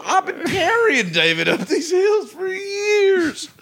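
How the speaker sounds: high, laughing, slow wail; exasperated laughing wail. Acted. Mock outrage over carrying David.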